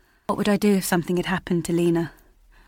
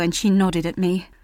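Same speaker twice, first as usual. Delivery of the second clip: ordinary delivery, nothing marked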